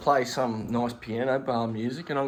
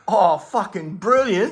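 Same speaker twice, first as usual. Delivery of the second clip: higher, louder, slow gleeful relish; delighted; 8 semitones higher, 7 dB louder, slower than usual. Retold or imitated. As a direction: savour the lucky break. Thrilled at getting in.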